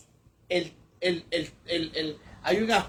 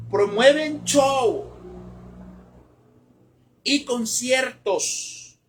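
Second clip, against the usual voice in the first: scornful; 7 semitones higher, 5 dB louder, much slower than usual. Acted. Mocking the emergent church's shows.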